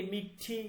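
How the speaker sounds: "মিঠি" with a clearly aspirated ঠ; teacher modelling the ঠ in "মিঠি"; aspirated retroflex ঠ. Shown as a demonstration. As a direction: say "মিঠি" with a breath puff on the retroflex t. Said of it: "ঠ এর উচ্চারণ".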